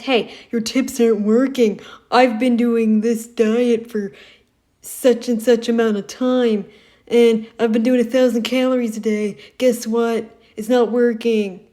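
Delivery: loud put-on complaining viewer. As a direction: act out an angry follower's complaint. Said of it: "I hope you start getting complaints".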